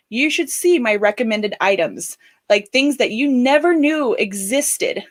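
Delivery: ordinary talk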